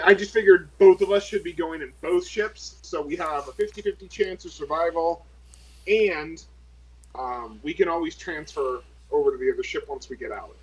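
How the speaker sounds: ordinary delivery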